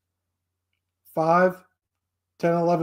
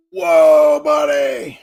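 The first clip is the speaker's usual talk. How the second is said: high, loud, excited mock commentator; Kino Casino host impression; 6 semitones higher, 8 dB louder, much slower than usual. Imitating the Kino Casino host reacting to DSP news in a high hyped voice. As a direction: shout it like an amazed hype host. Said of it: "That should be a t-shirt".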